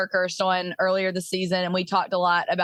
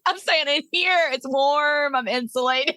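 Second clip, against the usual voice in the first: playful, higher put-on baby's-eye voice; unborn baby; 4 semitones higher than usual. Host joins in as the baby refusing birth, playful high voice. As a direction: start high and ease down, joking as the baby.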